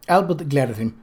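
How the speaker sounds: ordinary delivery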